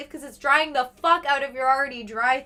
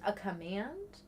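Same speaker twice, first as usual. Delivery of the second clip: whispered question; 4 semitones lower, 12 dB quieter than usual. Real, not acted. Reads chat puzzled.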